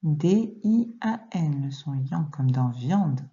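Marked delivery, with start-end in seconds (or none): 0.0-1.7 slow, separated spelling; letter by letter. Shown as a demonstration. Teacher correcting a pupil's dictation, letter by letter. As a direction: spell it out with gaps between letters.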